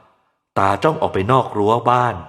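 ordinary delivery, nothing marked

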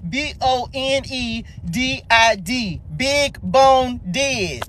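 slow, deliberate letter by letter, then word by word; spelling it out. Shown as a demonstration. Joking to viewers about how you spell it, spelled out slowly. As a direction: spell it slowly, then draw out BIG BONE DID. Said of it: "How you spell that?".

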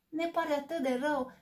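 high, squeaky mouse apology. Acted. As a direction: squeak out a hurried sorry. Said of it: "chițăi șoarecele".

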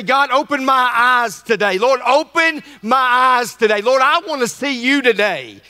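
slow, lifted prayer voice. Shown as a demonstration. Pastor leading the church in prayer, earnest slow prayer. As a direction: pray it out slowly and earnestly.

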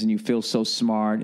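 ordinary delivery

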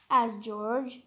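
ordinary delivery

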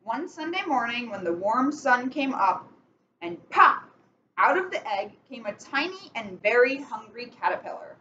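slow storybook reading with steady rhythm; picture-book read-aloud, measured and clear; reading to children. Acted. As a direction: read it like bedtime story time for kids. Reads picture book to kids.

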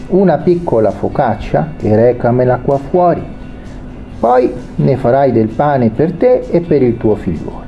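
ordinary talk

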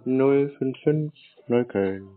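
slow, careful dictation of the digits; slowing down so the caller can write it. Real, not acted. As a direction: spell out each number slowly. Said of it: "ein bisschen langsamer".

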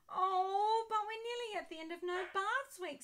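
high, loud disappointed wail. Acted. Ben pleads with Mum at the week's end, disappointed wail. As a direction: whine it out like a let-down kid. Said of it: "feeling disappointed".

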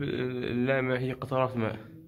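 ordinary talk

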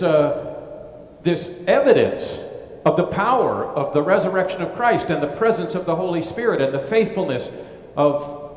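ordinary delivery

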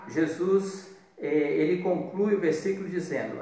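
usual speaking voice

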